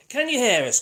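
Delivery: loud mic check. Real, not acted. Mic test, calling out loud.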